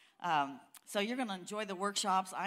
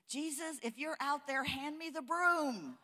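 put-on higher little boy voice, slow at the end; a small boy calling into the dark porch; timid boy calling out; 6 semitones higher than usual.

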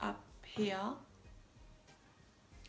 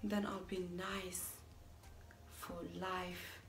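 subdued dread; 2 semitones lower, 8 dB quieter, slower than usual. Acted. Facing being nice for life, hushed gloom. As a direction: let it sink in quietly, much softer. Deflated after imagining acceptance.

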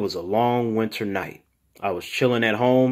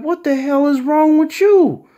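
much higher and louder mock yell; 7 dB louder than usual. [name] yells at his crying friend, a high mock yell. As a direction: shout it in disbelief.

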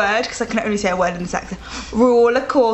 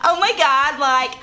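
put-on California girl accent. Giving a friend another clue, California girl accent. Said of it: "I think it's like a Cali girl".

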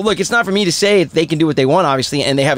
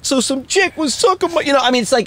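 higher, brash comic voice; Andrew Dice Clay impression; 8 semitones higher than usual. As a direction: do the brash stand-up bit. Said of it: "When he was like".

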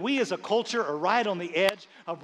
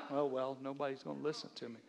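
low, much softer, quicker aside; resigned aside; 7 semitones lower, 14 dB quieter, faster than usual. Acted. Preacher giving up on the congregation mid-point, resigned aside. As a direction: drop right down into a resigned mumble to yourself.

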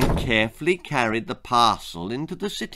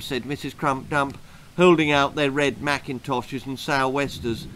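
ordinary delivery

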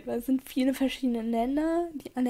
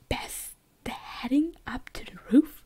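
slow, puzzled questioning; baffled, drawn out into a question; confused misreading; 3 dB quieter than usual. Real, not acted. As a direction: read it haltingly as if it makes no sense. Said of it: "ich war so verwirrt".

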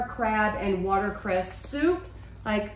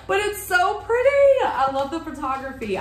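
excited gush; 7 semitones higher, 4 dB louder than usual. Real, not acted. Gushing over photography.

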